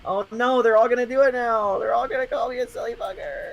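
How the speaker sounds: high, whiny mock panic; mock dread. A streamer dreading chat's teasing, high and whiny. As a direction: wail in mock despair.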